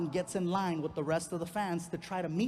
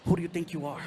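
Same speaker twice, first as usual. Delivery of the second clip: rehearsing a showdown in the mirror; rehearsed macho confrontation; 3 semitones lower than usual. Shown as a demonstration. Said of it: "I'm doing this".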